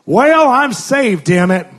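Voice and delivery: high, loud, slow angry announcement; slow, loud mock-angry grandma; grandma's angry declaration. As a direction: bark it out like a cranky old grandma. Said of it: "she looks so angry".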